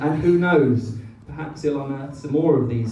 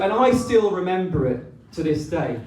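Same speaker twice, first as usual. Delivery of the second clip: usual speaking voice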